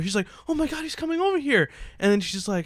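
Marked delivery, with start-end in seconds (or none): 0.5-1.7 high mock-starstruck gush; excited fangirl. Fan seeing Brett Favre come over, excited mock fangirl. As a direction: imitate a thrilled fan, pitched way up. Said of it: "Ultimate fangirl".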